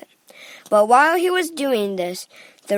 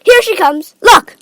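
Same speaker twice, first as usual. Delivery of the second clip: loud, high announcement; excited calling; 9 semitones higher, 12 dB louder than usual. A narrator's reading. Wonka pointing out the arriving boat, excitedly loud. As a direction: call out in excitement.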